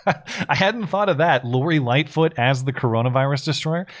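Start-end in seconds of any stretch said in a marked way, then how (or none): 0.0-1.5 laughing. Real, not acted. Laughs at cohost's costume joke.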